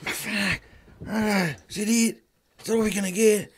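complaining. Retold or imitated. Teasing colleague's complaints about corporate show food, grumbling. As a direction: imitate a let-down moaner groaning over dinner. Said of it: "What about the complaints?".

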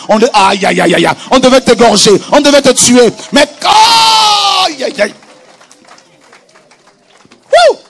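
loud, high, exultant preaching cries; prédication à pleine force. Real, not acted. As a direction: proclaim it at full voice, drawing out the cries. (prédication exaltée, criée à pleine voix)

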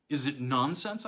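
nonsense rising, held in check; suppressed anger. A narrator's reading. Narrator asking Hawberk back, anger held down. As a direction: keep the anger under the words. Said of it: "managing to suppress what I felt".

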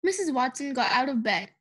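ordinary delivery, nothing marked

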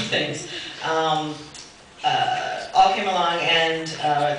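grandly reads out a flowery letter, half-laughing; formal letter reading. Acted. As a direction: deliver the absent chair's pompous message like a ceremonial speech. Reads absent bid chair's letter.

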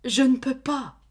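put-on girl crying out her refusal; tearful. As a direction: say it through tears. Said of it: "Elle se remit à pleurer".

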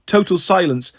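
plain reading voice

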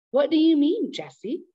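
put-on startled old woman demanding answers; alarmed grandma. Confused grandma confronts Jesse.

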